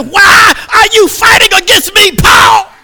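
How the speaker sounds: high, shouted divine rebuke; God's thundering voice. Acted. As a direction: thunder it down from heaven. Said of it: "God said".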